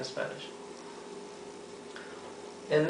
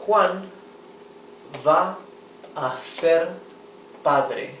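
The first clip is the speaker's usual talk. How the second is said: slow word-by-word reading; 3 semitones higher, 3 dB louder, slower than usual. Shown as a demonstration. Teacher reading a sentence to students, word by word. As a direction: read it out word by word for the class. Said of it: "teacher reads example slowly, word by word".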